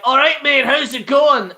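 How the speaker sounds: exaggerated Scottish demo; Scottish accent. Shown as a demonstration. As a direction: show off a broad Scottish accent. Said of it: "Scottish is like".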